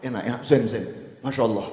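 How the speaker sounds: low, soft murmur of passive approval; quiet mock appreciation; imitated nodding listeners. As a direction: mumble along like a drowsy congregation. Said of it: "yang lain mantuk".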